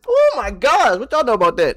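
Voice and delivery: excited squeal-like exclamation; excited outburst. Real, not acted. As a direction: burst out, caught up in the song. Reacting after rapping.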